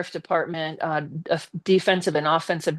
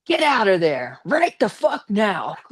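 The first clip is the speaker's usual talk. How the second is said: angry order; 3 semitones higher, slower than usual. Retold or imitated. Confronting driver at his truck.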